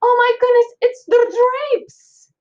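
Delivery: high, loud, slow gasp of alarm; alarmed aunt. Acted. Aunt discovering the burning drapes, shrieking in alarm. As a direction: cry it out as she spots the fire. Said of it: "she said, pointing toward the window".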